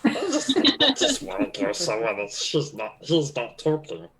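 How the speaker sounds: a hint of a strange put-on bodyguard voice; odd bodyguard voice. Bodyguard grumbling to the spoiled passenger, lightly put-on voice. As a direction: bodyguard speaking in a slightly odd voice. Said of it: "I don't know why I'm talking like this".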